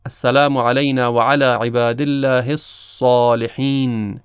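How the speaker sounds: الصالحين with a heavy ص, set against the light س in السلام; recited with emphatic saad. Shown as a demonstration.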